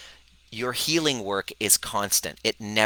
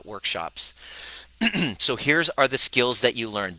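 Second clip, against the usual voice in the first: normal talking voice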